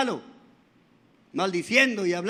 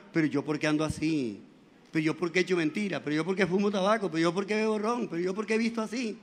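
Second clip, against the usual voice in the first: self-questioning conscience; 5 semitones lower, 6 dB quieter than usual. Retold or imitated. Voiced inner conscience questioning.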